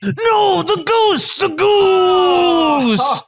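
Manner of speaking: high, drawn-out wail of horror; hammed-up despairing shout, stretched at the end; anguished cry. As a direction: cry out as if disaster struck. Chef sees the goose fall.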